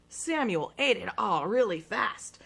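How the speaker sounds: plain reading voice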